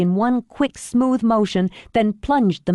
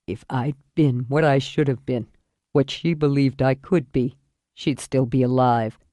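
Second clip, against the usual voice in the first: low, weary man's voice; dying father; 6 semitones lower than usual. Acted.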